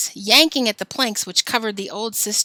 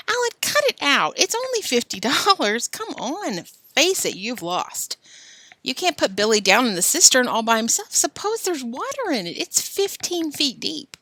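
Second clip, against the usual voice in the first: put-on high girl voice, pleading; Ellen pleading; 7 semitones higher than usual. Ellen begging Alan, high and pleading. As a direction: talk him out of it.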